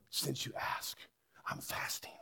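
faint, put-on whisper of a hungry martyr; hushed, hammed-up suffering; feigned weakness. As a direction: whisper it weakly, hoping to be pitied and admired. Fasting woman shows off her suffering.

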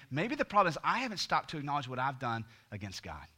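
ordinary talk, nothing marked

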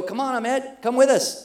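high, bright call to a friend; urging him loudly to join. Retold or imitated. Calling Ahmed to come along.

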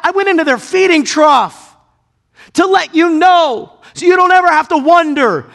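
high, loud, pleading proclamation; voice of God. Acted. God assuring doubting listeners, loud and high. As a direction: preach it as God's own promise. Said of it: "God made an emphatic stamp".

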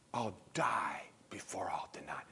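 hushed whisper; whispered vow. Acted. Peter whispering his vow to Jesus. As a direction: breathe the vow under your breath.

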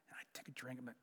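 soft whispered aside. Real, not acted.

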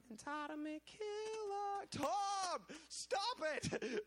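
very high, slow, sing-song teasing call; playful high-pitched ribbing of Tom; mocking teammates. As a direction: taunt a friend in a squeaky mock voice. Team teasing Tom, voiced.

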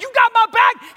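very high, loud shouted check; escalating, excited demand for assurance; fired-up question. Retold or imitated. Escalating question to his friend.

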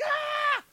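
high mimicked "ah" of relief; sudden high-pitched cry, acting out her reaction; imitated gasping cry. Injured girl as the knee pops back, imitated high cry. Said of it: "So she was like".

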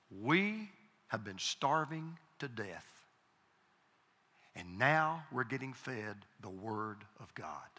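very slow, weighty quote; solemn retelling. Retold or imitated. Preacher retells wife's realization to congregation, solemn retelling. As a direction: space out the phrases, letting each one sink in.

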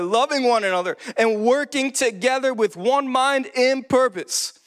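rally the congregation as you read the verse; energetic preaching. Real, not acted. Preaches and reads scripture with rising energy.

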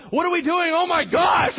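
high, loud panicked squeal of a voice; panicked pig shout. Acted. Preacher voicing the pigs as demons rush in, panicked high shout. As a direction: scream it like a terrified pig.

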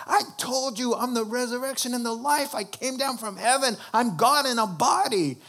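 high-pitched, impassioned retort; exasperated insistence. Retold or imitated. Jesus insisting to people without a clue, acted exasperation.

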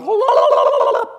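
high shrill trilling la la la; ululating cry. Retold or imitated. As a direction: ululate like a wedding celebration. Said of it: "you've heard the women going".

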